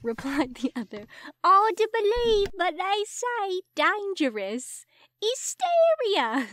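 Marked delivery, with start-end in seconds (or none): 1.4-6.5 high, loud Cockney constable; slow squeaky Cockney gossip; hammed-up high Cockney; Cockney accent. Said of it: "I can't do a Cockney accent".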